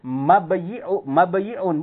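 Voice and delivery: mabayi'un with the yi sounded separately; incorrect uncontracted reading. Shown as a demonstration. Teacher showing what not to say, mabayi'un with yi. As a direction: keep the y before i in mabayi'un. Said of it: "lafadznya itu bukan".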